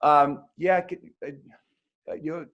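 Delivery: halting, stumbling start to a question; stumbling over the question in front of the hockey legend; stammering nerves. Retold or imitated. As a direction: stutter and trail off like a starstruck reporter. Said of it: "I go".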